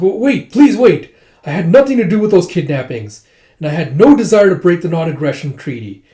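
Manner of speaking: high, loud acted protest; frantic pleading. Accused lord begging the envoy to wait, frantic pleading. Hazara pleads against threatened destruction.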